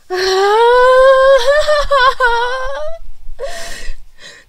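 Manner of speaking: long, high, rising sob; real crying wail. Real, not acted. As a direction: sob out loud, overwhelmed with emotion. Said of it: "estoy llorando". (llanto agudo y largo de emoción)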